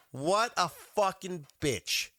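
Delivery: high, slow, punched-out insult; exasperated outburst. Real, not acted. After the lady hangs up on him, exasperated insult. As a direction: spit each word out in disgust. Insulting the woman who hung up.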